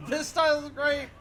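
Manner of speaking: excited. Real, not acted. Delighted by new fighting style.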